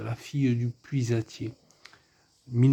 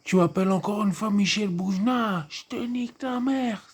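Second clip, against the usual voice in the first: high, far-off tough-guy voice, much higher; imitating Roger Hanin; 10 semitones higher than usual. Vlogger imitating Roger Hanin's film character threatening someone. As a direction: ham up a hot-headed gangster threat. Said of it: "Comment il fait, il fait".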